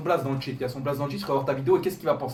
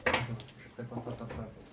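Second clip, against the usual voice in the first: hushed; 3 semitones lower, 10 dB quieter, much slower than usual. Real, not acted. Baby asleep nearby.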